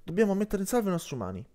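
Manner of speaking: fast, urgent report; hurried. Acted. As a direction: rush the words out, there's no time. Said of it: "disse in fretta Marra".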